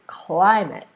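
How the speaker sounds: CLIMATE with a loud, punched CLI; climate, loud up front, then dropping; first-syllable stress. Shown as a demonstration. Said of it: "how to create that stress".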